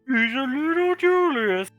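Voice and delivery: put-on high, cutesy baby talk; gushing, high-pitched fawning; doting coo. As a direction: coo it like pinching a little one's cheek. Cooing over child, squeezing cheek.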